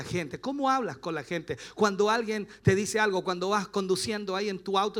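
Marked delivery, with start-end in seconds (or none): none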